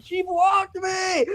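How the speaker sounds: high, slow mock-crying whine; shrill mocking whimper of a sulking kid; whiny crybaby. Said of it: "complained and cried to all of his fans".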